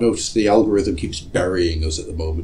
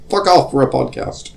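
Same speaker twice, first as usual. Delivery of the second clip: dismissive retort; 5 semitones higher, 3 dB louder than usual. Acted. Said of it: "And we're like".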